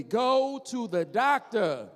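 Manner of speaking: slow, drawn out, each word spaced; slow chant for the crowd to repeat; slow call-and-response. Shown as a demonstration. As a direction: lead the crowd slowly. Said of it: "Say it with me".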